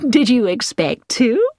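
gently mock her surprise; amused. A narrator's reading. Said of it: "Missus Baker seemed amused".

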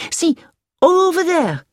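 high, loud French-driver call; eager pointing out. A narrator's reading. Driver points out the hospital, eager. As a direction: draw attention to it eagerly.

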